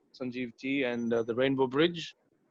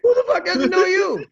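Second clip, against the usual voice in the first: much higher and louder, laughing amazement; excited disbelief; 9 dB louder than usual. Retold or imitated. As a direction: blurt it out overwhelmed with excitement. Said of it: "And I was like".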